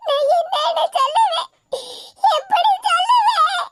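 high-pitched wailing lament; acted weeping, drawn out and high; crying. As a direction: wail helplessly, stretching out the words. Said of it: "என்னத்துக்கு இப்படி அழுதுகிட்டு இருக்க".

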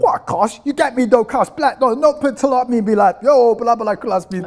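put-on loud, high London street accent. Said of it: "daha böyle zencilerin". (abartılı siyahi Londra sokak aksanı)

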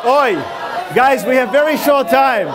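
calling over crowd. Real, not acted. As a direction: cut through the audience noise. Shouting over the noisy audience.

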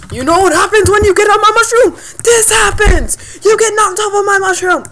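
loud, high hammed-up scolding; mock angry tirade. Player telling off a rival who got knocked off, mock angry tirade. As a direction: rant at full volume in mock outrage.

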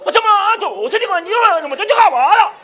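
high, babbling caricature of a Chinese man; mock Chinese diner. Chinese diner answering the waiter, high mock-Chinese babble. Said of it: "Y el chinito decía".

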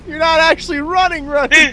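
high, loud frantic yell; being chased. Real, not acted. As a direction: urge them on in panic. Yelling at teammate while chased.

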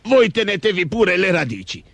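lightly voiced high ogre; a high creature voice, easing downward. A narrator's reading. The ogre continues his choice. (voce acuta da orco, leggera)

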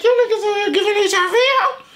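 high, loud, shocked squeal; shocked disbelief. Retold or imitated. Reacting to the song lyric, a shocked high squeal. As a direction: shriek it like you can't believe what you heard. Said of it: "I'm over here, I'm like".

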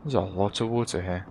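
low, soft aside; understated, low-voiced observation; quiet remark. Real, not acted. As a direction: say it quietly to yourself. Muttered remark, then emphatic repeat.

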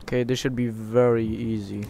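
usual speaking voice

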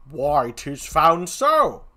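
slow comic gravedigger reply; lightly put-on clown voice; second clown. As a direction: draw it out as the other clown.

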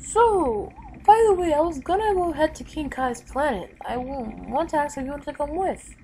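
cheerful hero inviting a friend along; Goku voice. Acted.

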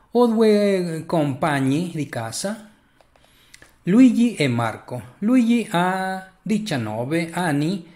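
reading the Italian passage clearly for a learner; lesson text read aloud. Acted. Teacher reads an Italian passage aloud to a student, clear reading voice.

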